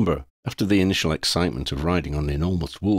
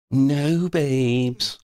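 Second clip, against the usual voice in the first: offhand shrug; 8 semitones higher, 3 dB louder than usual. A narrator's reading. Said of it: "Freddy shrugged".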